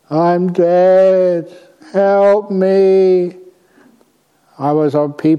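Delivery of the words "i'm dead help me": put-on higher, louder, eerie plea of a dead girl; pleading ghost girl. Dead girl's ghost begs the dreamer, eerie and pleading. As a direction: cry out like a lost ghost begging for help.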